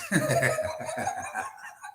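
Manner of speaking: airy unvoiced chuckle; breathy laugh. Real, not acted. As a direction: laugh under your breath. Laughing at manager's excuse.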